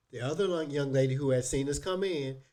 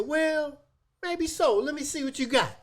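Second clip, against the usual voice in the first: much higher, teasing put-on voice; mock flirty; 12 semitones higher than usual. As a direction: lift it high as a playful pretend flirt. Hypothetical flirty reply to counter lady.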